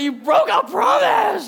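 high, loud, slow accusing shout; indignant child yell. Retold or imitated. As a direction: wail it like a wronged little girl. Daughter voiced, shouting.

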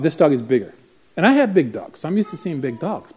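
usual speaking voice

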